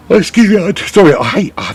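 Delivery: talking through a yawn; words stretched by a yawn. Real, not acted. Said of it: "I hate yawning to you guys".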